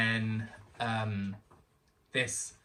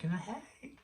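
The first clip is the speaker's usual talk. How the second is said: very high, very soft breathy hey; 10 semitones higher, 5 dB quieter than usual. Retold or imitated. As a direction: greet them like a gentle diva. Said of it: "she was like".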